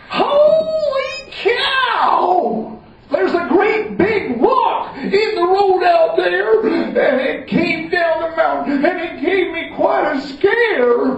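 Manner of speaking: mock panic, big and slow; wailing Common John. Common John tells the wizard about the rock, mock wailing.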